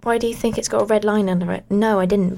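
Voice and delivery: usual speaking voice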